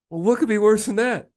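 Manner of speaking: lightly voiced anxious patient; high worried question. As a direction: ask it high and fretful. Patient in doctor joke.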